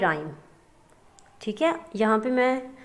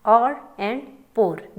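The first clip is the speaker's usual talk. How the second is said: or and pour spoken very slowly, word by word; teacher slows right down on or and pour; slow deliberate read; 3 dB louder, much slower than usual. Shown as a demonstration. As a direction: spell out or and pour slowly so the class hears the difference. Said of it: "देखें अब difference है ना".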